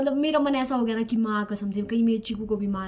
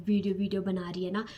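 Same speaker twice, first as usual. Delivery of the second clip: ordinary delivery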